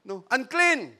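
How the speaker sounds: high, loud mock cry of an outcast; a hammed-up cry of unclean; leper's warning cry. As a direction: warn people off as the leper. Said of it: "sabihin mo".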